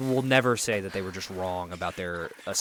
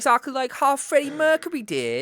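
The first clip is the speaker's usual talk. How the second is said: British accent; 4 dB louder than usual. Shown as a demonstration. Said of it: "You sound exactly that".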